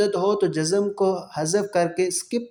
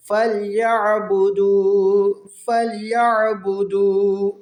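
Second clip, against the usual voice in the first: slow, drawn-out tajweed chant; تلاوت کا انداز; Quran recitation; 2 semitones higher, 4 dB louder, slower than usual. Shown as a demonstration. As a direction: stretch the long vowels like a reciter. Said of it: "بار بار پڑھیں".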